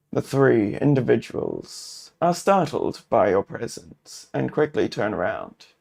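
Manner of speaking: ordinary talk, nothing marked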